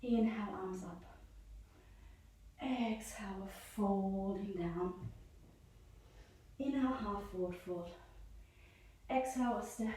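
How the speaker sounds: soft, slow, smooth yoga cues; gentle and slow. Shown as a demonstration. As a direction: ease the class into slow gentle movement. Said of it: "let's just really gentle, really slow".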